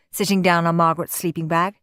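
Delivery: usual speaking voice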